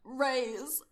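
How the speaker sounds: bad Southern accent. McCree calling to Reyes, bad Southern accent. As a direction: call out in a put-on Southern accent. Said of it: "do the bad Southern accent".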